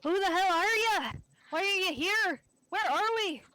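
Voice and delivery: high, loud panicked demand; frantic shout. Acted. Cornered adventurer yelling at the kobold. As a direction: shout it out, scared and confused. Player voices panicked captured character.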